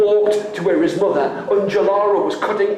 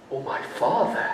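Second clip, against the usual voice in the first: soft; 5 semitones lower, 8 dB quieter, faster than usual. Acted. Son speaks softly.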